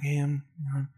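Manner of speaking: soft, lisping put-on voice; a light take on a lisping boxer; Mike Tyson impression. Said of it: "He's like".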